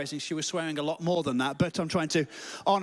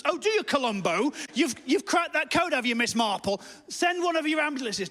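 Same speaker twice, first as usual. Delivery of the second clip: high, mocking outburst; sarcastic retort rising into a desperate demand; panicked sarcasm; 7 semitones higher, 3 dB louder than usual. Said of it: "I'm panicking at this point".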